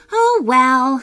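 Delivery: a carefree verbal shrug. A narrator's reading. Sophia watching the sandcastle collapse, shrugging it off. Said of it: "Sophia said with a shrug".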